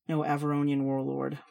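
ordinary delivery, nothing marked

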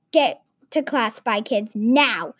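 usual speaking voice

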